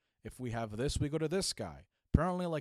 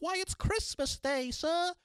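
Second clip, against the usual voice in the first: put-on much higher, eager little boy's voice; Christmas Carol street boy; 3 dB louder than usual. Answering the Scrooge man as the eager street boy. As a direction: chirp it like the boy under Scrooge's window. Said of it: "like Ebenezer Scrooge at the end of A Christmas Carol".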